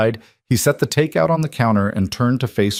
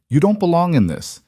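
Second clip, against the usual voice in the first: cold, hard, higher-pitched You don't belong; icy voice; 9 semitones higher, 3 dB louder than usual. A narrator's reading. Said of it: "his voice was ice".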